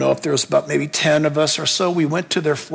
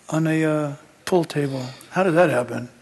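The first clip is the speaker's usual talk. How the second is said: low, hollow voice as if from far away; eerie possessed voice, dropped low; distant demon voice; 4 semitones lower, 3 dB quieter than usual. Retold or imitated. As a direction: sound like it comes from across the room. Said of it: "change their voice and they sound like their voice is thirty, forty, fifty feet away".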